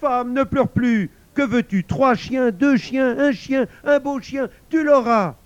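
very high, full-volume shouting; desperate yell. A narrator's reading. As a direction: shout frantic promises to stop her leaving. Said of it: "Il hurla".

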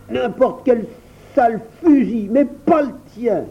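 high, tight, spiteful hiss; hissing through teeth. A narrator's reading. Barter refusing Hassel's carbine, hissing with spite. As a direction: spit it out through clenched teeth. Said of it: "siffla Barter entre ses dents".

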